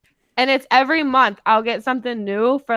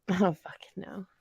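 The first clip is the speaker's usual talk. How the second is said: whisper; 2 semitones lower, 12 dB quieter than usual. Real, not acted. Echoes friend in a whisper.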